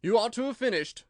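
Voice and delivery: a king scolding his subject; loud reproving royal voice; stern king. A narrator's reading.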